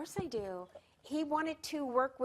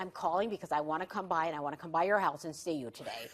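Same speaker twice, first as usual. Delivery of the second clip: low, quick disguised voice; fast prank-call voice, pitched way down; [name]; 9 semitones lower, faster than usual. Retold or imitated. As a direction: rattle it off deep, posing as a producer. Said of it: "it's [name]".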